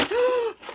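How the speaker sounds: sharp high gasp; a big pretend gasp of shock; mock gasp. Gasping in mock alarm at the cut wolf.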